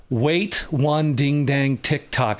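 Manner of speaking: plain reading voice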